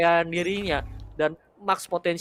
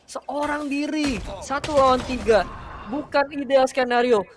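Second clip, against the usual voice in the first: hyped casting; 9 semitones higher, 6 dB louder than usual. Real, not acted. Dramatic clutch moment in casting.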